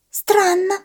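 very high squeaky chick voice; peeping baby chick. Acted. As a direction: peep it like a tiny chick. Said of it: "пропищал цыплёнок".

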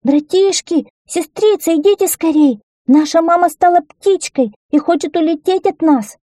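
put-on high, urgent child's cry; excited high-pitched shouting of a child; child calling out. A narrator's reading. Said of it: "и закричал".

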